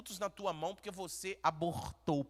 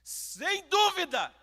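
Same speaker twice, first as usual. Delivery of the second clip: fiery shout; shouted preaching; 13 semitones higher, 11 dB louder than usual. Shown as a demonstration. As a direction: cry out sem dúvida. Shouted take.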